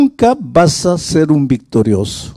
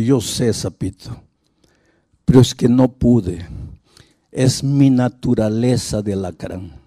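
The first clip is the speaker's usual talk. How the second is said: low, soft, a touch of mock weeping; understated tearful confession, voice sunk low; crying scorpion; 7 semitones lower, 3 dB quieter than usual. Scorpion confesses to the frog, mildly tearful and low. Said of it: "el alacrán llorando le dice".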